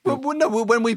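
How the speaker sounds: high, flustered protest; indignant protest. Real, not acted. Protesting golf claim.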